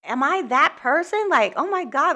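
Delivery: high, rising panicky disbelief; shocked panic with the voice pitched high; retold freak-out. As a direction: replay the moment you started freaking out. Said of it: "I started freaking out".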